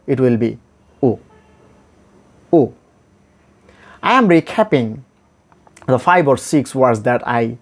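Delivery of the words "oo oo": OO short, midway between long and short u; a brief, half-relaxed "oo". Shown as a demonstration. Teacher demonstrates the middle sound, in-between oo. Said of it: "So middle of oo and oo".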